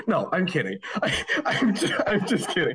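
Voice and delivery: high-pitched, helpless laughter through the words; barely getting it out for laughing; laughing confession. Real, not acted. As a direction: break up laughing while owning up to the joke. Admits the accent story was joking.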